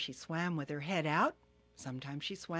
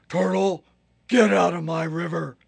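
loud, heavy hippo command; big bossy Hippo; 13 dB louder than usual. Acted. Hippo ordering Turtle out, loud and gruff. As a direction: boom it out like a grumpy hippo. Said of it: "But Hippo said".

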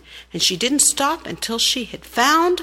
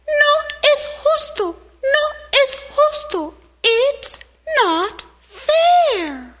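much higher, loud indignant little ant voice; little ant crying out; 4 dB louder, slower than usual. Acted. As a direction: squeak out the unfairness like a tiny furious ant. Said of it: "and cried out".

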